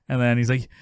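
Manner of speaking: ordinary talk, nothing marked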